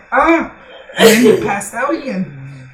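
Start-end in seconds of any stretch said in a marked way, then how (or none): 0.1-0.5 sudden loud yelp of pain, pitched high. Retold or imitated. Friend woken by a kick to the face, loud pained yelp. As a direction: yelp like you just took a kick to the face. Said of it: "he was like".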